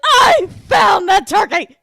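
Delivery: loud, slow, high triumphant shout. Retold or imitated. Stage crew finding the missing turkey, triumphant shout. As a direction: yell it out in relief. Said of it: "being like".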